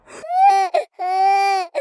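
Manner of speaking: a loud, high, drawn-out crying wail; mock wail. Girl retelling her crying at school, loud mock wail. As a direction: wail it out like a hurt kid, hammed-up. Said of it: "and I'm like".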